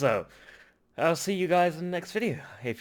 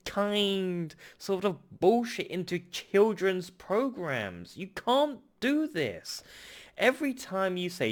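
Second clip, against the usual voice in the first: angry rant; 6 semitones higher, slower than usual. Real, not acted. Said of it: "I'm sorry I'm getting angry".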